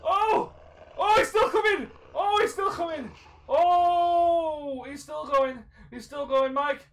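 high, loud cries while being chased; panicked yelling. Real, not acted. Calling to Mike as it keeps coming, panicked high yelling. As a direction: yell the alarm in real panic. Monster chasing him, calls friend.

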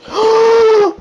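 sharp loud high gasp; excited gasp. Real, not acted. Spotting iron ore in the cave, loud excited gasp. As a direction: gasp in delight.

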